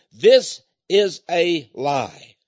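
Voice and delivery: very slow, firm denunciation; slow emphatic this is a lie; declaring. Retold or imitated. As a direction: hammer each word. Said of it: "She said".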